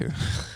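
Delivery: imitated soft goofy chuckle; goofy laugh. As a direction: mimic a man's silly staccato chuckle. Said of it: "he just does this goofy laugh".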